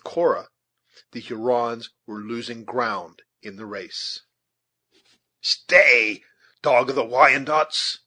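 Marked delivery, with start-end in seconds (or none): 5.4-8.0 loud, forceful command; fierce shouted insult; exclaimed challenge. A narrator's reading. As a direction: hurl it as a challenge at a fleeing enemy. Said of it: "exclaimed Uncas".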